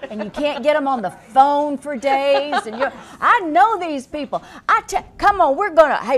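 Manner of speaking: loud, high call to arms; fired-up rally. Real, not acted. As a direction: whip up a crowd against the swindlers. Rallying against cable companies.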